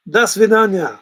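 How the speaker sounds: a hammed-up Russian goodbye; Russian accent. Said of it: "In my best vodka voice".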